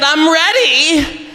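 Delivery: very slow, high, drawn-out come-on; seductive producer. Retold or imitated. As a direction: stretch it out like a come-on.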